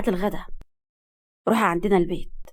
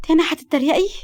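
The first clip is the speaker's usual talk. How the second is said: higher, wounded question; hurt, sad; 7 semitones higher than usual. A narrator's reading. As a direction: ask as if stung by teasing. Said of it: "زينب بحزن". (حزن وعتاب)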